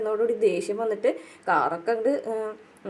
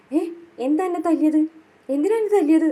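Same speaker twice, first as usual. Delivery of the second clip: mock hurt protest; 8 semitones higher, 5 dB louder than usual. Brother protests being slapped.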